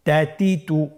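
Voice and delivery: measured chant with held syllables; even, deliberate syllable recitation; recitation drill. Shown as a demonstration. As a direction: lead the class through joined syllables. Saying the drill together.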